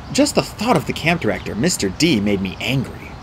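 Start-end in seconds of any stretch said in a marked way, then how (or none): none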